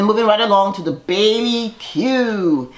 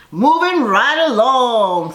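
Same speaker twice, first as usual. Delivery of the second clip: sing-song segue; 6 semitones higher, 4 dB louder, much slower than usual. Real, not acted.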